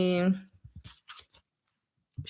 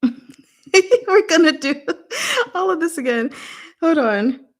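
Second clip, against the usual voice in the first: laughing; 9 semitones higher, 3 dB louder than usual. Real, not acted. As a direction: laugh while saying you'll redo it. Laughing at a failed stroke.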